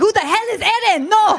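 mock jealous husband. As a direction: ham up a jealous boyfriend who mishears. Jealous Iranian husband, acted quarrel.

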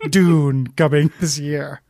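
movie trailer voice. Pretend. Mock movie trailer line.

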